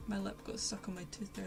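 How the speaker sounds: usual speaking voice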